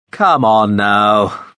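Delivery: slow, loud, drawn-out coaxing call; impatient coaxing. Acted. Veppers coaxing the hidden girl, slow loud call. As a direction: drag out come on now like a bored master.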